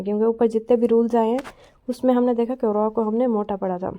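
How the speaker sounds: ordinary talk, nothing marked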